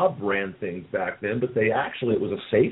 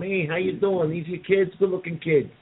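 higher, lightly voiced mobster greeting; a hint of a wiseguy; Joe Pesci type; 7 semitones higher than usual. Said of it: "the Joe Pesci type going".

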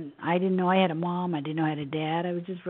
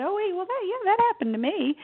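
much higher, sudden dawning surprise; surprised realization; 12 semitones higher than usual. Retold or imitated. Remembering realizing it was a near-death experience, high surprised. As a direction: let it hit you all at once, up high.

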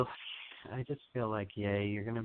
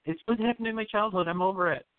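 higher pitched mock of someone brushing it off; dismissive inner voice; 10 semitones higher, faster than usual. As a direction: play the person insisting they're fine. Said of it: "naturally you think".

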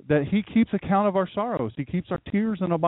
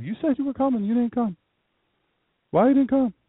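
high, mocking complaint voice; grumbling folks; 8 semitones higher than usual. Mock the church folks griping that he never came, high and whiny. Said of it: "I don't think they talk like that, that's just my rendition".

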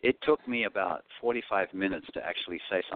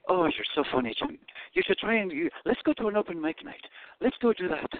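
higher-pitched imitation of an encouraging woman friend; Irish actress friend; 11 semitones higher than usual. Irish actress friend urging him to try open mic, high-voiced imitation. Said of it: "And then she would say".

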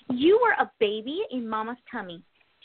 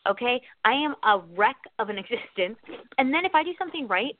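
ordinary talk, nothing marked

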